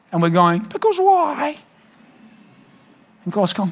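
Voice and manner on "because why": put-on childish why. Believer voiced as child.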